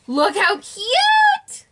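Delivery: high, loud and very slow gushing; excited squeal. Real, not acted. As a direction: stretch it out in sheer delight. Showing off prized set.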